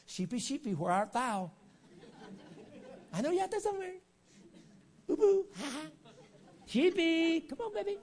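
silly, very high coaxing falsetto; mock sheep calling. Preacher mimicking a feeble shepherd calling his sheep, silly falsetto. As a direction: call a lost pet in a hammed-up high voice.

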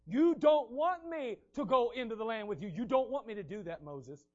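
God thundering at the people; loud stern warning. Acted. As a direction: press the warning hard. Preacher voices God's warning.

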